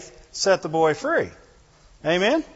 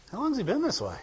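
higher, softer, totally calm question; unbothered calm; 7 semitones higher, 6 dB quieter, faster than usual. Acted. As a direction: ask as if nothing could rattle you. Jesus calm before convulsing boy.

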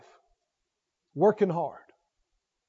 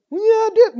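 higher, louder indignant insist; indignant protest; 9 semitones higher, 6 dB louder, faster than usual. Acted. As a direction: insist it, offended. Acted argument with a doubter.

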